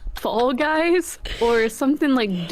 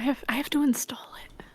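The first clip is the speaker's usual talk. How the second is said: hushed unvoiced whisper; 6 dB quieter, faster than usual. Real, not acted. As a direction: whisper it under your breath. Whispered aside.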